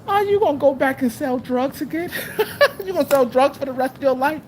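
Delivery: a mocking high voice with a laugh; mock annoying voice. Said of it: "annoying little voice".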